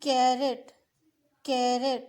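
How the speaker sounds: Carat with कैर hit hard and एट quick; stress on KAIR. Shown as a demonstration. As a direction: punch कैर, rush एट. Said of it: "कैर को हमने जोर दे के कहना". (Carat में कैर पर ज़ोर, एट जल्दी)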